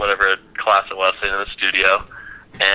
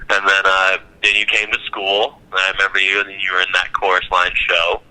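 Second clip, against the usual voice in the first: normal talking voice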